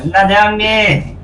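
long drawn-out call. Pretend. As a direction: call him slowly across the room. Calling out to the king of hell.

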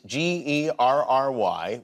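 G E R R Y slowly, letter by letter; spelled out. Shown as a demonstration. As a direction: spell out G E R R Y without rushing. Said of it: "your name is, is".

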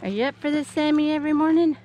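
talking to a pet. Real, not acted. Talking to dog Sammy.

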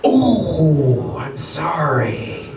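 long, drawn-out game show "sorry"; mock regret. Host breaking a wrong answer to contestant, mock regret.

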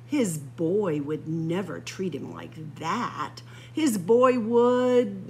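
ordinary delivery

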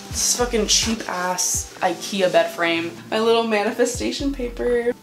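ordinary talk, nothing marked

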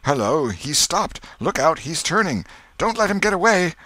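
high, excited cries rising to alarm; crowd calling out. A narrator's reading. Crowd spotting Potter turning back, excited calling out. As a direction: raise the alarm in an urgent, climbing voice. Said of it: "from twenty voices".

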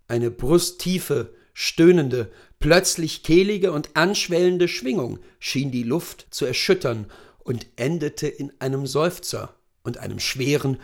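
plain reading voice

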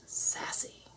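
sassy whisper; a breathy, sly whisper. Acted. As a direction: hush it out with attitude. Listing own features, tail.

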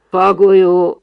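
loud, cavernous reply; booming hollow voice. A narrator's reading. Pop answering from under the diving mask, booming voice. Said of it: "válaszolta Pop öblös hangon".

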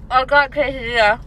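talking through a mouthful; words round a bite of food; mouth full. Real, not acted. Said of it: "don't talk with your mouth full".

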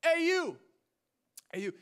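hey you, hollered across the campus; retold yell. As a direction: call out to a stranger twenty feet away. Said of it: "And so I yell at him".

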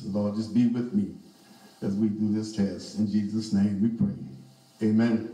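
low, quick murmured prayer. Real, not acted. Pastor praying to the Father before preaching, low and rushed. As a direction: pray softly and quickly before the sermon.

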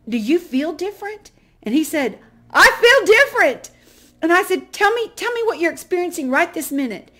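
loud, high, thrilled retelling; excited. Real, not acted. Telling the audience about the old man's prayer, excitedly. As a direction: pour out the excitement of that moment. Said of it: "and I was so excited".

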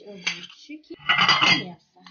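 normal talking voice

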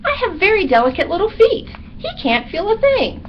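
high, quick, cocky little bat; breezy high-pitched bat. A narrator's reading. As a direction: chatter high and light as a small bat. Bat character in read-aloud story.

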